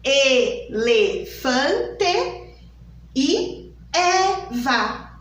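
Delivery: slow "Elefante" closed E, "Eva" open E; closed E then open E. Shown as a demonstration. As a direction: contrast the two E sounds. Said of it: "ele teve dois sons". (E fechado em "Elefante", E aberto em "Eva")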